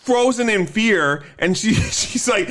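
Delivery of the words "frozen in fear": usual speaking voice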